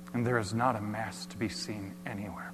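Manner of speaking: ordinary talk, nothing marked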